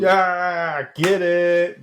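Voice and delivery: loud high cheering; frat bro cheer. Pretend. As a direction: hype him up, shouting. Cheering him on.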